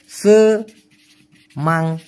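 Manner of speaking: সেমাঙাত drawn out very slowly, syllable by syllable, ending on ng-at; slow pronunciation. Shown as a demonstration. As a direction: spell out সেমাঙাত slowly as se-ma-ngat for the learner. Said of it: "উচ্চারণটা হবে সেমাঙাত".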